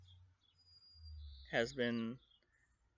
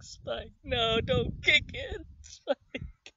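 very high, crying plea; begging Spike; 11 semitones higher, 4 dB louder than usual. Retold or imitated. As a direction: wail at him to stop. Begging Spike to stop kicking.

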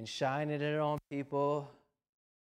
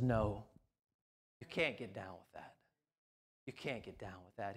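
lower, softer and grave; Jesus answering firmly; 9 semitones lower, 6 dB quieter than usual. Retold or imitated. Jesus answering the compromisers, in a low grave firm voice. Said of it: "Jesus comes with ... and says".

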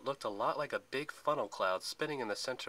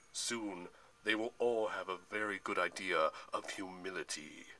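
menacing Atlas; 3 semitones lower than usual. A narrator's reading. Atlas promises the gods his burden, menacing. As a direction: pronounce the threat with ponderous grandeur.

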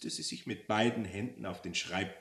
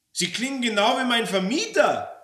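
high, loud exclamation of recognition; astonished, indignant yell; accusing shout; 11 dB louder than usual. Acted. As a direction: blurt out the discovery. Exposes the voice as her landlord.

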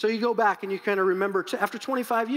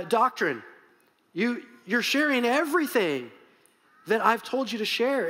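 ordinary delivery

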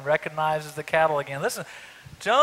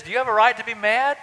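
challenging Lord; 8 semitones higher, 9 dB louder than usual. Retold or imitated. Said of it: "And the Lord says".

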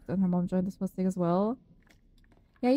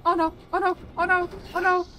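panicked oh no; 8 semitones higher than usual. Real, not acted. Panicking at a dodging enemy.

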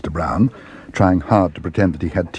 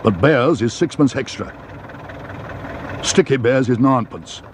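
London cabbie character voice; unimpressed cabbie naming a surcharge; taxi driver; 3 semitones higher than usual. A narrator's reading. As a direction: state the fare like a firm cab driver. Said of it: "said the driver".

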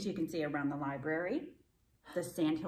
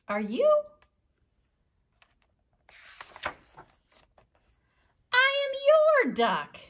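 lively read-aloud with high character voices; storytime reading; 8 semitones higher, 7 dB louder than usual. A narrator's reading. Librarian reading to children, animated character voices. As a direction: read the duck story to the kids, bouncing between voices. Reads picture book with voices.

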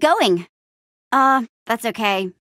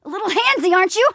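high, strained quip; effortful banter mid-struggle; struggling; 9 semitones higher, faster than usual. Acted. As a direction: quip while fighting off a grab. Grappled by an attacker.

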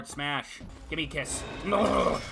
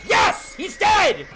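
excited shout; 8 dB louder, much slower than usual. Real, not acted. Streamer reacts to game win.

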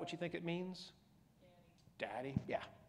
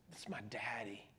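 whispered tender; 7 semitones lower, 6 dB quieter than usual. Acted. Intimate cry to God as father.

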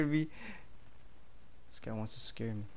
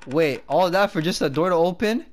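excited; 7 semitones higher, 7 dB louder than usual. Real, not acted. Door opens after all that.

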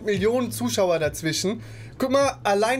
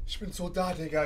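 from another room; 3 semitones lower, 9 dB quieter than usual. Real, not acted. Other streamer muted, calling over.

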